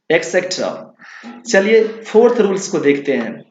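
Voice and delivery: ordinary delivery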